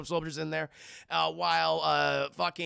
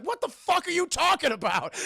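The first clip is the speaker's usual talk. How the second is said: mock outraged shout; 11 semitones higher, 5 dB louder than usual. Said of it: "He's like".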